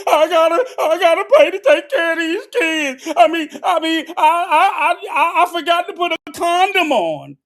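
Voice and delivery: high, loud whining imitation of a crying man; mocking whiny man. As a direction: whine it like a pathetic man complaining. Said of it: "men who cry and compl".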